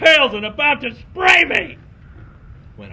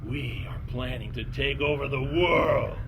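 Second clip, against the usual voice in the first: Skunk King; 5 semitones lower, 7 dB quieter than usual. Acted. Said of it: "the Skunk King looked at me and said".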